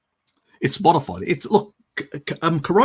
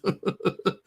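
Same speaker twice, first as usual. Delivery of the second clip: short laugh; 8 semitones higher than usual. Real, not acted. Laughing at own joke.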